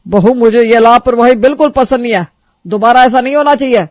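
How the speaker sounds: very loud final warning; father-in-law warning bride, stern. Acted. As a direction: lay down the law. Kailash warns.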